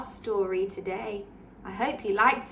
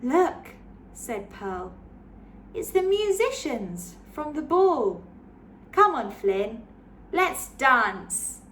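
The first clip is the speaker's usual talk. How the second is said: put-on lively read-aloud for children; story narration; 3 dB louder than usual. A narrator's reading. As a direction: read it like a bedtime picture book. Picture book read aloud.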